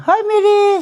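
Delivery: high, loud, cheery hello; excited high greeting. Retold or imitated. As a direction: sing out the greeting. Greeting Mere, retold.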